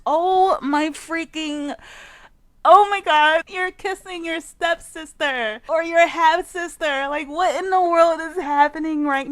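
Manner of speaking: scandalized shout; shocked outcry. Real, not acted. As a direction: react like you can't believe your eyes. Shocked reaction to Sims kiss.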